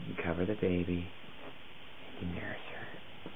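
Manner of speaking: whispering. Real, not acted. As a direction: whisper it gently so only she hears. Adult coaching child during demo.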